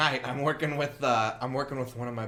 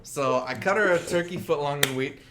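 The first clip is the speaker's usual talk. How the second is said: usual speaking voice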